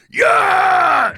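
long, high full-volume yell; overmodulated loud cheer. Retold or imitated. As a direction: imitate a fervent supporter screaming approval. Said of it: "it's, like, overmodulated and loud".

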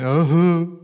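loud, high hammed-up moan; exaggerated boo-hoo moan; mock moping wail. Preacher showing how you start moping, mock moan. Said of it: "anytime you start moping".

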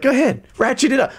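high, fast taunt. Retold or imitated. Homeowner daring contractor to escalate, taunting. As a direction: goad him on. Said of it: "it's like".

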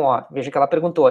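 ordinary talk

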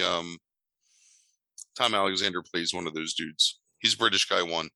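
normal talking voice

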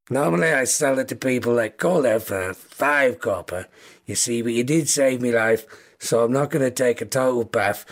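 understated Scouse newspaper seller; Scouse kobold. Acted. Kobold quotes a customer the paper's price, in Scouse. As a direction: haggle matter-of-factly in a Liverpool accent. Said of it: "very thick scouse kobold".

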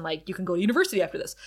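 usual speaking voice

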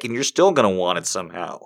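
usual speaking voice

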